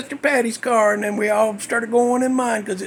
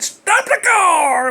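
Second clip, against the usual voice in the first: yelled order; 6 semitones higher, slower than usual. Retold or imitated. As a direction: bark it out in a panic. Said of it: "and I said".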